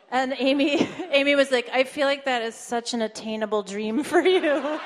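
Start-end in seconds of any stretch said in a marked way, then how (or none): 1.7-4.8 laughing. Retold or imitated. Amy reacting to the stripper answer.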